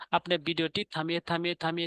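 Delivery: ordinary talk, nothing marked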